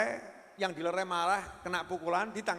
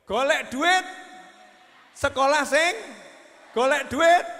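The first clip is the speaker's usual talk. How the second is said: loud, nagging parent hammering golek duit; mock parents barking orders at a child; imitating loud nagging parents; 4 semitones higher, 9 dB louder than usual. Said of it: "Kalimatnya ibu-ibu dan bapak-bapak".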